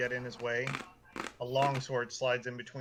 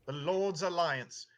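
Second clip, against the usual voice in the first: slow, raised-pitch officious repetition; emphatic guard; 5 semitones higher, slower than usual. Acted. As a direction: spell out the title slowly for a dimwit. Guard repeats after "The what?.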